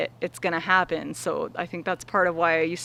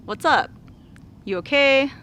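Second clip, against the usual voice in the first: friendly check-in; 6 semitones higher than usual. Shown as a demonstration. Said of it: "just like you would ask a friend".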